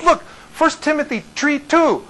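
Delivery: high, insistent "three" said as "tree"; exasperated high retelling, "three" turned into "tree"; Belizean th as t. Retold or imitated. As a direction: imitate him explaining, "three" with a hard t. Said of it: "They can't pronounce the, the th".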